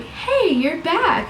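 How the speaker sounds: high, drawn-out hello; mock-excited, high welcome; excited greeting. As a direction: greet a returning face, pitched high and stretched. Acting out his surprised greeting.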